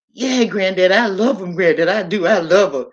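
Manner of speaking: loud, earnest pleading to granddad; emphatic insistence. Retold or imitated. Granddaughter insisting to granddad she loves him, loud and earnest.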